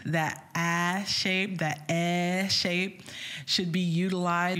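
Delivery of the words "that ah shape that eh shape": very slow, stretched vowel shapes; drawn-out vowels. Shown as a demonstration. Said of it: "remember your oropharynx".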